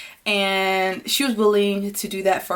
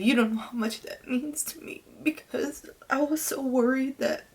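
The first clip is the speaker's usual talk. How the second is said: real tearful voice, thin and whispery; choked up, voice catching; crying; 4 semitones higher, 5 dB quieter than usual. Real, not acted. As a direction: push the words out through tears. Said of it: "why am I crying".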